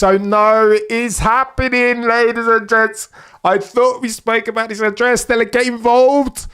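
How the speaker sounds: can't believe it's happening; excited. Real, not acted. The swap finally happening live.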